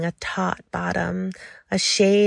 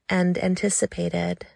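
ordinary delivery, nothing marked

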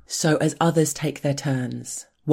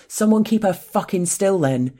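loud, higher roar of command; 5 semitones higher, 4 dB louder than usual. A narrator's reading. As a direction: bellow the order. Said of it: "he roars".

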